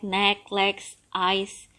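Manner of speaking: usual speaking voice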